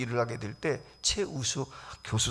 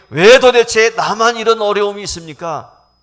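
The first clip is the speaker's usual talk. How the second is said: loud, higher anguished cry; loud complaint; 9 semitones higher, 17 dB louder than usual. Retold or imitated. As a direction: shout the old complaint. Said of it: "라고 했던 분이". (큰 소리로 원망하듯)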